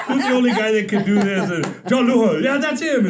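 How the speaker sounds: amused, laughing rhetorical question. Retold or imitated. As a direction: savor the joke through laughter. Repeating the joke, laughing.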